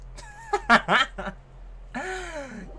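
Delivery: high, open laugh of amusement; bright laugh. Real, not acted. Streamer laughing at a viewer's build, open laugh. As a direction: laugh out at the chat's joke.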